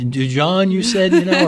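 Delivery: put-on Dujon said high in a clumsy Cajun accent; a fumbling Cajun-accented Dujon; attempted Cajun accent. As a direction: try a Cajun accent and miss. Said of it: "I can't get that Cajun accent down".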